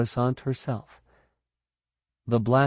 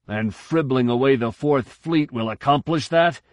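indignant admiral; 5 semitones higher than usual. A narrator's reading. Admiral voiced challenging.

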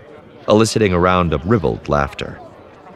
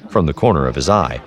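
usual speaking voice

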